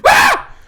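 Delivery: very high, loud burst of a scream; sudden scream. Shown as a demonstration. Comic shows how he startles the neighbors, sudden scream. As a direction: scream abruptly out of nowhere. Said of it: "I just scream at the top of my lungs".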